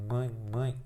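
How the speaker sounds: hushed, low nasal demonstration; tiny voice, as if privately checking the nasal sound; very softly. Shown as a demonstration. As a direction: murmur MÃE through the nose. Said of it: "bem baixinho".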